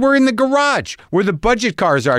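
retold high-pitched protest; defensive retort. Renter telling the clerk where he looked, defensively exasperated. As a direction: answer back in a high, exasperated voice.

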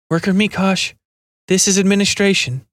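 a weary, worried radio call; haggard, worried operator. A narrator's reading. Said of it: "Her voice was haggard and dull, worried".

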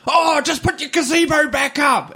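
very high-pitched imitated nagging; mock exasperated order. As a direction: holler it like a woman fed up with her neighbour. Acted quarrel between two women.

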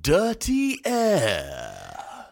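slow, stretched show-title call; announcer voice. Acted. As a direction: draw out the show name. Announcing the podcast name.

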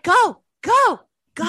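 high mock yell of go go go; hammed-up yelling at the car ahead; impatient driver. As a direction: hurry them along like a driver at a stop. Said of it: "you're just like".

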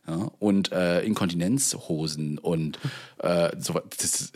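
normal talking voice

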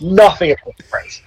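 half-whispered repeat for emphasis; emphatic half-whisper. Real, not acted. Stressing how little the card's winner knows, half-whispered. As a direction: drop into a breath on the repeat.

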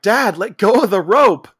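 high, loud, drawn-out call; mock panicked yell. Son yelling at skiing father.

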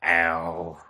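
imitating a drunk actor's slur; drunk slurring. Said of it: "legitimately slurring his words".

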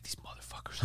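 near-silent, voiceless whisper of annoyance; whispered grumble. Retold or imitated. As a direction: imitate a man cursing under his breath. Retold grumble of the upset friend.

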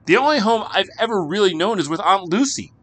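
put-on high, loud pleading boy. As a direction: beg desperately. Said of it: "he implored".

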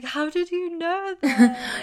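high, drawn-out imitation of a caught-out boyfriend; mock astonishment.

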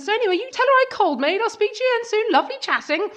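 high, loud and fast sign-off; chatty old lady. Retold or imitated. The old caller wraps up the chat in a rush. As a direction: rattle off a cheery goodbye quickly. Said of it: "at the end went".

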